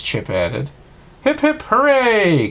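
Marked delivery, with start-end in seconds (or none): 1.2-2.5 high, loud, slow cheer. A narrator's reading. Cogsworth leading the toast to Belle, loud slow cheer. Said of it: "Cogsworth cheered".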